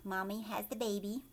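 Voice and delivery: ordinary delivery, nothing marked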